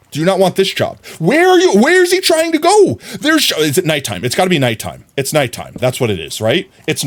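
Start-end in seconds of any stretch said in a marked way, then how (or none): 0.1-3.5 exasperated yell. Real, not acted. Yelling at wandering villager.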